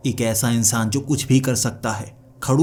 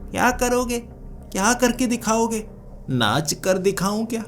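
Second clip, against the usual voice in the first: high-pitched, baffled string of questions; flustered questioning; 10 semitones higher than usual. Acted. In-laws demand proof he can keep her happy, flustered high questioning. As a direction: throw the questions out high, at a loss.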